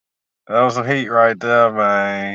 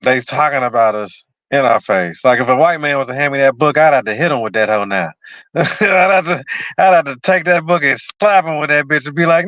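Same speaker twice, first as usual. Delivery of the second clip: ordinary talk, nothing marked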